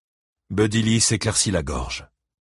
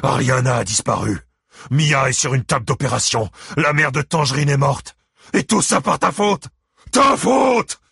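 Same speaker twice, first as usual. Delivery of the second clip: high angry tirade building to a shout; spitting accusation; 7 semitones higher, 5 dB louder than usual. A narrator's reading. Ike blames Buddy Lee for the disasters, furious and building. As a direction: hurl the blame, louder and higher to the end. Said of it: "cracha Ike".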